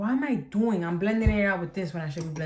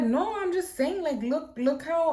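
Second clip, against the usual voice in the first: grandma voice, high; 9 semitones higher than usual. Retold or imitated. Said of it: "my grandma's like".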